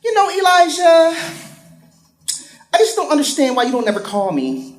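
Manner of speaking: lightly voiced complaining woman; quoting a woman. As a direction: whine it like a needy woman. Older woman quoted.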